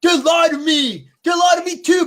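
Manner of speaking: high, shouted rapper impression. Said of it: "That's my [name] voice".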